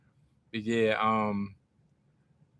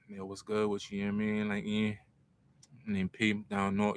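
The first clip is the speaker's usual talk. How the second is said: corny pickup line; 3 semitones lower, 8 dB quieter than usual. Retold or imitated. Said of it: "So corny".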